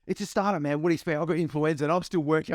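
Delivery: high, exasperated imitation of a sick cofounder; mock indignant cofounder. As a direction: play him indignant and high-pitched. Imitating sick Ben's retort.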